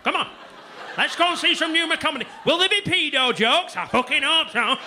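mock pensioners. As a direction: ham up pensioners planning a comedy night. Voicing pensioners planning night out.

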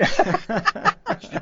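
high-pitched burst of laughter. Real, not acted. Laughs about unlikely role.

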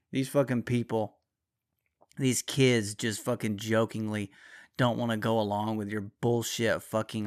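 normal talking voice

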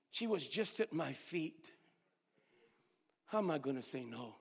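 normal talking voice

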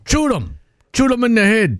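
high Cajun yelp of a catchphrase; hammed-up Louisiana bayou accent, pitched high; Cajun hunter accent. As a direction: bark it like a swamp gator hunter, high up. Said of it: "stopping the accent".

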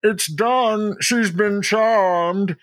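high, stretched-out triumphant lord voice; put-on high voice, slow and grand; Lord Radriel. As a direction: announce the conquest as a hammy fey hunk. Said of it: "hunk in there is like".

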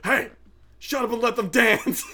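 loud mock tough-guy shout. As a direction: bellow it like a kid playing tough. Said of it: "like, a tough guy voice".

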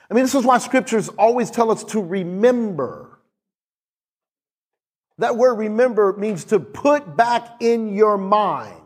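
normal talking voice

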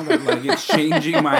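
laughing through the words. Real, not acted. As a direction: break into a laugh. Laughs mid sentence.

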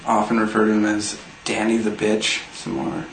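usual speaking voice

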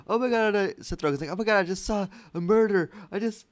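high-pitched frantic retelling; mock panic. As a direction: blurt it out in mock alarm. Acts out panicked film line.

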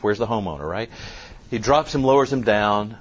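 normal talking voice

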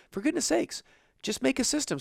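high, pleading push; exasperated. Real, not acted. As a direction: plead in disbelief. Exasperated urging.

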